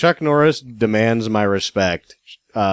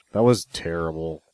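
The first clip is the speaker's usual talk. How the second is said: normal talking voice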